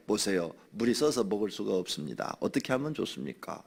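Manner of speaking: lightly acted humble request for advice; polite consulting. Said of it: "이렇게 의논을 하죠". (차분히 의논하는 공손한 말투)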